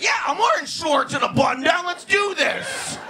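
bro voice. Retold or imitated. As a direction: ham up a cocky party guy. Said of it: "Anybody who's like".

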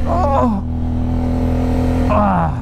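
groaning from a thigh cramp; pained groan. Real, not acted. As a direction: let out a short pained groan. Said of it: "ich hab einen Krampf im Oberschenkel".

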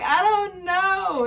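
high, loud, stretched-out mock wail; mock crying. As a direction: wail it helplessly, hammed-up. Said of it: "Why are you crying?".

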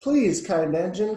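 slow, loud plea; pleading cry. A narrator's reading. Dolls begging a passing engine, pleading cry. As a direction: call out begging for help. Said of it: "cried all the dolls and toys".

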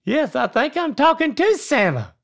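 high, squeaky elf. Acted. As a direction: pitch up like a little elf. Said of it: "you gotta have an elf voice".

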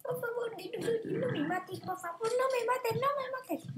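squeaky plea for mercy; high-pitched pleading. Acted. Begging dinosaur not to kill.

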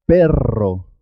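long trilled rr in "Perro"; rolled double R. Shown as a demonstration. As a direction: vibrate the RR of "Perro" strongly. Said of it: "suena doble".

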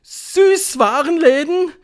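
high, drawn-out rising question; astonished gasp. A narrator's reading. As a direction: echo it in loud disbelief. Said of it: "japsten sie". (verblüfft japsend, hoch und fragend)

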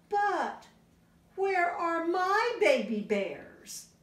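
high, slow mock-worried mother; high, slow Mommy Bear. Parent reading aloud, Mommy Bear searching, slow and high. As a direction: ask slowly, high and wondering.

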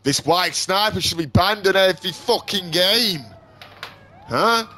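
high, loud rant building to a scream; furious gamer meltdown, slowing into drawn-out shouts; angry rant. Real, not acted. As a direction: work yourself up until you're screaming each word. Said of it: "I'm getting all angry".

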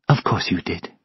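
very soft, near-whispered reply; subdued, whispery acceptance; hushed resignation. A narrator's reading. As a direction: say it softly, to yourself almost. Narrator voices grieving Sylvia.

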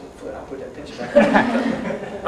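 put-on slow, breathy half-laughed whisper of a starstruck boy; shy kid voice. Little boy to high school star, hushed and laughing. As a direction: hush like a kid before his idol. Kid speaking to his hero.

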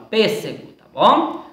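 ordinary talk

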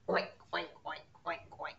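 mock oinking like a pig; pig oinks. Shown as a demonstration. Teacher showing preschoolers the pig sound, oinking. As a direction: oink like a cartoon pig. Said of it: "it makes a sound like this".

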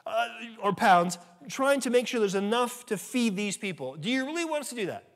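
hammed-up sarcastic retort; sarcastic disciples. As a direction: play the disciples scoffing at the order. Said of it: "almost a hint of sarcasm in their voice".

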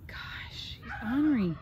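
whispered aside about the goat. Real, not acted. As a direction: whisper it to the camera.

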